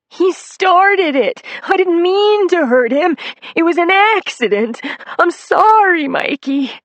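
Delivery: very high, loud, slow crying whine; high-pitched whine. Acted. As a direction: wail your excuses like a big baby. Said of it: "crying in his peculiar high-pitched whine".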